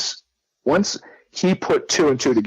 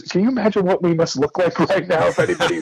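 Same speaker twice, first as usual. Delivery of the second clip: words broken up by laughter; retelling his father's amused question, laughing through it; laughing while talking; 6 semitones higher than usual. Retold or imitated. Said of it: "he starts laughing".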